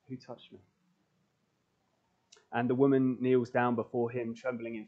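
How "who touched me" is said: softer, gently asking who touched him; Jesus turning to the crowd, softly asking; soft, searching question. Acted. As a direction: ask it softly, almost to yourself. Jesus speaks in the retold story.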